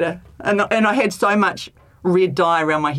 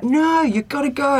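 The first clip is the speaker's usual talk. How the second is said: mock insistence; 9 semitones higher, slower than usual. Self-pep talk quote.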